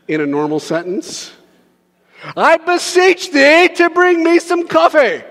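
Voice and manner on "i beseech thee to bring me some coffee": high, loud, slow hammed-up old English; mock archaic request. Preacher mocking archaic speech to the congregation, hammed-up. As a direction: ham up the fancy King James wording. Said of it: "When's the last time you used beseech".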